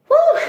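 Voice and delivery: high, loud whooping exhale; sharp, high-pitched whew. Retold or imitated. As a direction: let out a big high whew. Own exclamation while retelling a scare.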